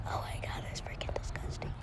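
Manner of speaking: horrified whisper. Real, not acted. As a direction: breathe it out in disgust. Whispers after swallowing bug.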